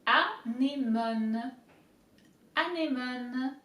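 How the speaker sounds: anémone said slowly, syllable by syllable; slow demo. Shown as a demonstration. Teacher slowly modelling anémone for viewers. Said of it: "let's break it down".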